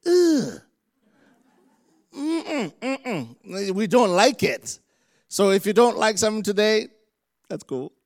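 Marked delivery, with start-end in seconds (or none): 0.1-3.7 squealed groan of distaste, then refusing hums; high reluctant "ugh" and mm-mm. Acted. Humans balking when God speaks, mock whiny refusal. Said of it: "humans go".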